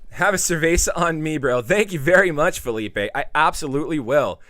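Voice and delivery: high, loud, beaming thanks to a donor; excited thanks. Real, not acted. Streamer thanking Felipe for a beer, loud and excited. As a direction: cheer the gift loudly.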